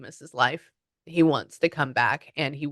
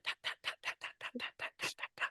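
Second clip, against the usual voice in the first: quiet, voiceless ta-ta-ta; rapid soft tapping sounds; 17 dB quieter, much faster than usual. Shown as a demonstration. Reader showing faces going on a wall, soft tapping noises. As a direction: tick off each placement with the tongue.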